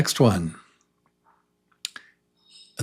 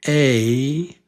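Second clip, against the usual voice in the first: ordinary talk, nothing marked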